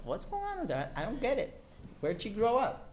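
high-pitched, incredulous husband to himself; baffled. Retold or imitated. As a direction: act out total bewilderment at her. Husband's baffled thoughts acted out.

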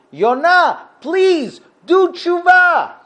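high, loud, drawn-out begging; desperate pleading. Retold or imitated. Sailors imploring Yonah as the storm worsens, desperate. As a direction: implore him, stretching the last words.

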